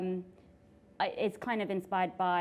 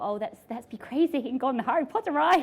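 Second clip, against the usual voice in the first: mock reckless excitement; 6 semitones higher, 7 dB louder than usual. Retold reckless idea.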